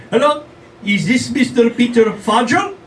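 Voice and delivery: high, slow scripted caller; robocaller voice. Retold or imitated. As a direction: sound like a call-center caller. Robocaller on the phone.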